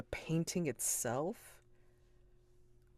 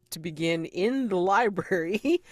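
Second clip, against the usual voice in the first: ordinary talk, nothing marked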